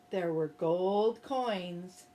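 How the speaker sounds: ordinary delivery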